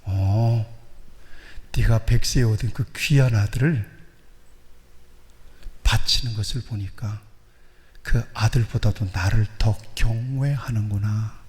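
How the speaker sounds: usual speaking voice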